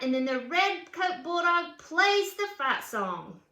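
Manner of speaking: lively read-aloud storybook voice; reading to children. A narrator's reading. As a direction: read it like a picture book for kids. Reads picture book aloud.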